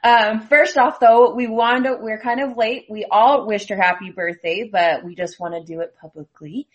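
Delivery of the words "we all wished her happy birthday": ordinary delivery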